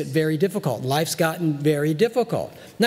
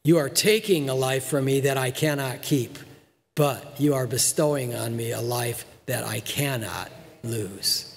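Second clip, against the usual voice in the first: second reading, softer and lower at the end; slow solemn reread; 6 semitones lower, 3 dB quieter than usual. Shown as a demonstration. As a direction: drop low and soft for the last word. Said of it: "Let me read that again".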